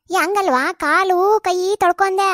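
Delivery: slow, stretched-out words; speaking to the hard-of-hearing. Acted. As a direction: spell it out slowly for someone hard of hearing. Repeating for hard-of-hearing old woman. (ಕಿವಿ ಕೇಳದವರಿಗೆ ನಿಧಾನವಾಗಿ ಎಳೆದು ಹೇಳುವುದು)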